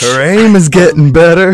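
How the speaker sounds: laughing through a quoted line; laughing quote. Retold or imitated. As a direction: giggle out the line. Quoting show line with laugh.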